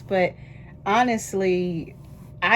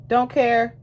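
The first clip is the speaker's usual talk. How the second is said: louder, sassy dismissal; defiant retort; 5 semitones higher, 6 dB louder than usual. Acted. Answering the imagined critic with a sassy dismissal. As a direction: brush the critic off.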